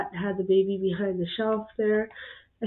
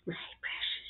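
raspy hissing whisper of a hoarder; Gollum whisper; 13 dB quieter than usual. Retold or imitated. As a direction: hiss it like clutching a treasure. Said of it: "just kidding".

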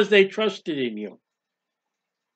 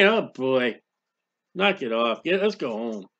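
much lower, softer, dismissive grumble; bored lower onlooker; 8 semitones lower, 6 dB quieter than usual. Acted. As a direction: shrug him off and head home. Bored onlookers leaving cross.

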